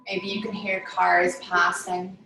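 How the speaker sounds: measured, soothing yoga nidra voice; guided meditation. Real, not acted. Yoga teacher settling listeners down for Yoga Nidra, guided meditation voice. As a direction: guide them into stillness, phrase by phrase with long pauses.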